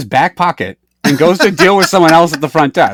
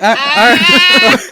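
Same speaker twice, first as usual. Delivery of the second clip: hesitant mock reply; 14 semitones higher, 4 dB louder, much slower than usual. Hesitant reply to drive-thru worker.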